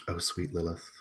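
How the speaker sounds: soft, lingering condescension; hushed, patronizing sweetness, with long pauses; infantilizing. Acted. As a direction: coo it softly, as if to a little child. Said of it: "very, like, infantilizing almost".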